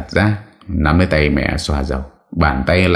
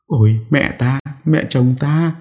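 moaning lament; 11 semitones higher than usual. A narrator's reading. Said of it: "Chợt bà Thủy rên rỉ".

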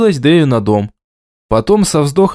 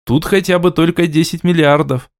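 ordinary delivery

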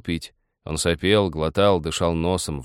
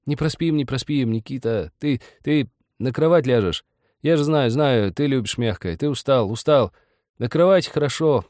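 higher, eager, repetitive reassurance; embarrassed babbling; 7 semitones higher than usual. A narrator's reading. Ilyusha offers Nikita the bed, flustered reassurance. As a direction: fuss over a tired friend. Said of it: "начинал как-то приборматывать, повторяя слова".

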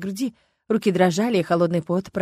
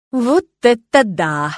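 slow, awed, louder wow; drawn-out admiration; 4 semitones higher, 7 dB louder, much slower than usual. A narrator's reading. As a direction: stretch it out in admiration. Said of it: "протянул, не скрывая восхищения".

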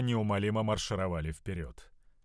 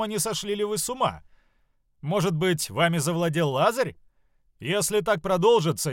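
high, officious scolding from a haughty overseer; self-important, indignant tirade; heated arrogance; 7 semitones higher, 3 dB louder than usual. Acted. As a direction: threaten to report them, pitched high and insistent. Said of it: "Утратив оттенок жаркой спеси".

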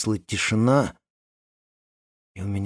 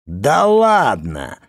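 drawn-out protest; 4 semitones higher, 5 dB louder, much slower than usual. Acted. Richard brushing off protest.

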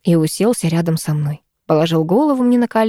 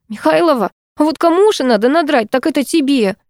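warning; 8 semitones higher than usual. Acted. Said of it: "предупредила Анаит".

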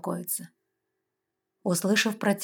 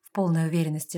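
plain reading voice

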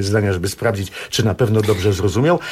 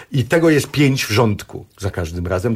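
ordinary delivery

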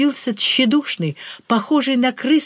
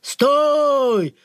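shouted; 4 semitones higher than usual. A narrator's reading. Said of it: "заорал очередной портрет".

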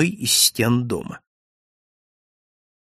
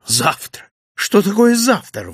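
high, louder indignant questioning; worked-up, incredulous protest; agitated; 9 semitones higher, 5 dB louder than usual. A narrator's reading. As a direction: challenge the word in rising agitation. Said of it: "взволновался я".

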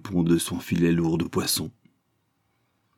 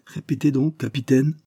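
higher, pointed demand; challenging commodore; 7 semitones higher than usual. Acted. Commodore daring the captain to repeat, pointed. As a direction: dare him to say it again.

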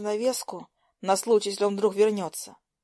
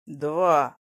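low, firm counteroffer; lightly voiced boy standing his ground; unyielding; 5 semitones lower, 3 dB louder than usual. A narrator's reading. Lucas haggles with the girl, not yielding. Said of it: "не уступал он".

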